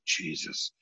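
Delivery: whispered retold aside; very soft, voiceless mutter; under the breath. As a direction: breathe it to yourself, barely audible. Muttered reaction to people staring.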